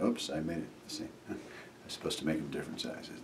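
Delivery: normal talking voice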